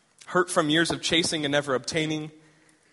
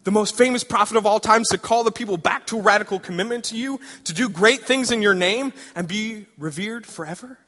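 excited Hosea; 4 semitones higher than usual. Acted. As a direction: pitch the big dream to God excitedly. Hosea dreaming of Isaiah's fame.

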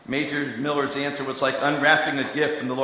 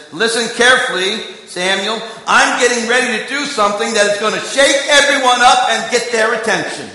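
much higher and louder proclamation; higher, louder, commanding call; booming voice of God; 8 semitones higher, 10 dB louder than usual. Acted. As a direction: announce it like God calling down to Samuel. Preacher voicing God's announcement.

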